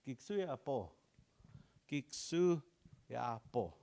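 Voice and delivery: ordinary delivery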